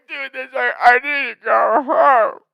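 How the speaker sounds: slow, whiny voice; mock panicked friend. Older brother tripping at the bar, mock panicked whine. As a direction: play the freaked-out older brother.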